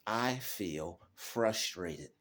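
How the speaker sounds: slow, deliberate, naming each feeling; measured self-talk, unhurried; slow calm self-talk. Shown as a demonstration. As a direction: calmly spell out the feeling to yourself. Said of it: "You have to say to yourself".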